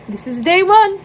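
bright proud declaration; excited. Real, not acted. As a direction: announce it triumphantly. Triumphant announcement.

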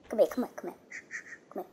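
whispered shh, shh to coax a pet. Real, not acted. Player coaxing the little monkey closer, whispering. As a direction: hush and whisper it like luring a shy animal.

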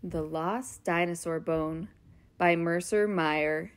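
plain reading voice

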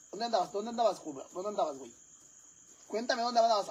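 high-pitched furious mom interrogation; furious mother. Acted. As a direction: grill the son on where he's been, shouting. Mother yells at returned son.

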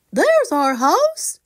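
put-on high, slow, eager child voice; excited little bear. Tommy Forgetful spotting a house, high and eager. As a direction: point it out like a delighted cub.

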